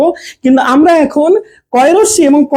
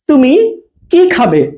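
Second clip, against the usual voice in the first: slow "তুমি", pause, long "কি খাবে"; weight on "কি", asking what; space before কি; much slower than usual. Shown as a demonstration. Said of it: "একটু space দিচ্ছি". ("তুমি"-র পরে ফাঁক, "কি" দীর্ঘ)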